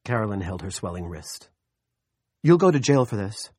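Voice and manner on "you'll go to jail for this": raised, loud threat from a hurt woman; trembling threat. A narrator's reading. Woman with a hurt wrist threatens Junior, trembling indignation. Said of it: "in a low, trembling voice".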